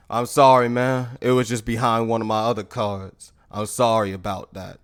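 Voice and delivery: low-pitched sheepish admission; deflated, low mock apology; subdued apology. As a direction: drop the voice and act out owning a mistake. Knife man sheepishly apologises.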